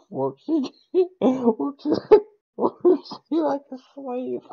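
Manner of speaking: high, slow, giggling; laughing. Real, not acted. To friends about being the lab partner, giggling. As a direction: squeeze the words out between laughs.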